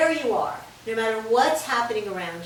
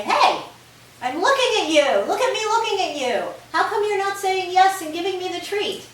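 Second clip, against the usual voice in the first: higher, louder voice of an impatient dog; talking dog voice; 9 semitones higher, 7 dB louder than usual. Pretend. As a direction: play the dog demanding its treat. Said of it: "the dog is gonna look at you and say".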